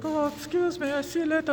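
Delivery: very high mocking falsetto; squeaky, put-on goodbye; roommate impression. Roommate leaving the coat on the bed, mock falsetto. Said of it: "and he's like".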